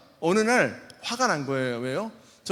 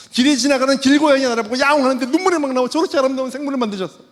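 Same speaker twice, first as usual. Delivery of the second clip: high, loud, tearful wonder; overwhelmed wonder; 8 semitones higher, 10 dB louder than usual. Retold or imitated. Converted man marvelling at a street cat and birds, high loud wonder. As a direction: gush over the beauty of creation.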